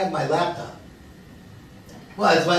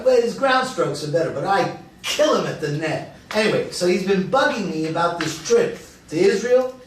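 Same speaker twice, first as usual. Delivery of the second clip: normal talking voice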